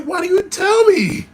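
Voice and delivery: slow, high-pitched, loud protest; appalled outcry. Retold or imitated. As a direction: wail it in baffled outrage. Appalled customer confronts cook.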